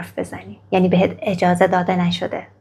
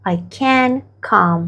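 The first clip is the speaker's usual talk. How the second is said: very slow, each word spaced out; slow model phrase; 2 semitones higher, much slower than usual. Shown as a demonstration. Teacher repeating the phrase very slowly for students. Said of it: "و تو میگی که".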